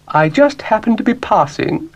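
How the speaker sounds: high, grand lady-cow voice; a fussy, genteel cow poking in; Ermyntrude the cow. Acted. Ermyntrude continues.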